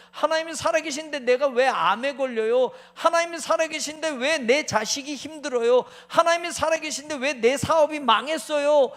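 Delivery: a believer crying out in pain to God; anguished complaint to God. Acted. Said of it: "하는 때가 있잖아요".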